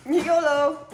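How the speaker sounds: loud, high catchphrase shout; playful cheer. Pretend. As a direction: whoop it out playfully.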